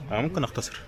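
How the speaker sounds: ordinary talk, nothing marked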